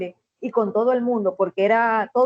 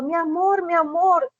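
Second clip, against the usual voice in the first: higher, eager call to her husband; excited joke wife; 5 semitones higher, slower than usual. Retold or imitated. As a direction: run to him delighted, calling out. (esposa emocionada llamando a su amor)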